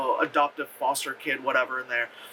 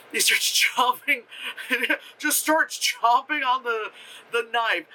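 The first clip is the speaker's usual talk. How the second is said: telling it through giggles, pitched up; laughing; 7 semitones higher than usual. Real, not acted. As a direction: crack up while getting the words out. Cracking up at own retelling.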